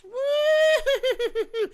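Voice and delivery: high, loud burst of laughter; shrieking laugh at own joke. Real, not acted. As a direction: crack up loudly.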